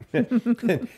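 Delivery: high laugh. Real, not acted. Laugh after quoting banker.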